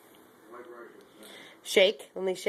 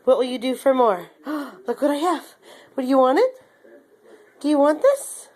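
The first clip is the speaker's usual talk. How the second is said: high, eager coaxing voice; talking to a dog; 5 semitones higher, 3 dB louder, faster than usual. Real, not acted. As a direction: dangle the treat and coax. Teasing dog with treat.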